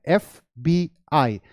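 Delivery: "एफ़बीआई" spelt out slowly, F, B, I; three separate letter names in "एफ़बीआई", spaced apart. Shown as a demonstration. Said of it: "कैसे pronounce करते हैं". ("एफ़बीआई" एक-एक अक्षर अलग, धीरे)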